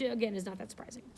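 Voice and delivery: ordinary delivery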